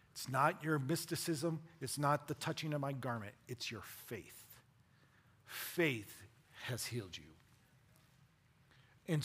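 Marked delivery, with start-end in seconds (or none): none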